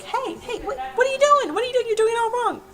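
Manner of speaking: high-pitched mock alarm; retold calling out. Churchgoer correcting a botched baptism, high retold call-out. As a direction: imitate an urgent correction in a high voice.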